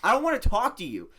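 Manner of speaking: loud, high mock fury; T-Bone, furious. Villager rejects the player after repeated net attacks, furious. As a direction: yell it as a villager who has had enough. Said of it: "he got even more furious".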